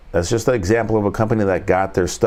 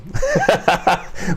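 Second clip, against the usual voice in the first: high laugh; 9 semitones higher than usual. Real, not acted. Laughs at own bit.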